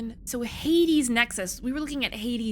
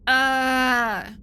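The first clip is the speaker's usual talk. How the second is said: loud, drawn-out frustrated "uhh"; frustration yell; 3 semitones higher, 6 dB louder than usual. Real, not acted. Gamer fed up with the quest system, frustration yell. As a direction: yell out one long groan of frustration. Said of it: "that's my frustration yell".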